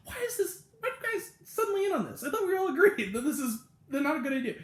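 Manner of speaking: the one sane friend losing patience; exasperated disbelief. Retold or imitated. Mikey Day exasperated at the frat.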